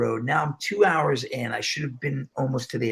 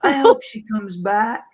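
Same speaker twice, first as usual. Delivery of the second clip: high, slow, drawn-out lady voice; impression of a lady; 9 semitones higher, 3 dB louder, slower than usual. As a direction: play a lady plaintively voicing her sadness in a high pitch. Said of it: "I love this one lady".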